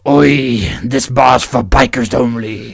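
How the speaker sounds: loud, put-on biker voice. Biker turning newcomers away, loud drawn-out warning. Second take of biker script.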